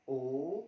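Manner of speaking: ओ said loud with rounded lips; loud rounded ओ demo; rounded vowel. Shown as a demonstration. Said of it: "व्रत बन जाएगा".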